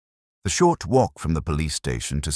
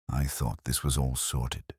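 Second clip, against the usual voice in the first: softer, hushed sergeant's aside; 3 semitones lower, 6 dB quieter than usual. A narrator's reading. Sergeant murmuring nervously to the inspector, softer and hushed. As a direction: keep it low so nobody overhears. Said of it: "he whispered".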